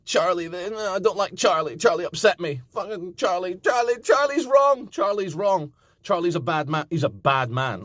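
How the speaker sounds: high whiny mock rant; high hammed-up whiny rant about Charlie; imitating ranting Danny. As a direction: whine like a sulking rival. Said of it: "Danny launched into".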